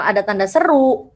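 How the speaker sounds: ordinary delivery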